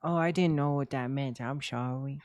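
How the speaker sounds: lightly put-on innocent apology; hint of a wide-eyed innocent act; playing innocent. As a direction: fake a sheepish little sorry. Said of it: "I can pull that card".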